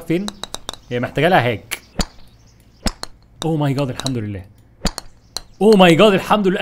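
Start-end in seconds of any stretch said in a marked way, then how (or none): 5.6-6.6 louder, higher relieved cry; relieved exclaim. Real, not acted. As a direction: blurt out in relief. Relief after a hard climb. (صيحة ارتياح أعلى)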